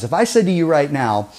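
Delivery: ordinary talk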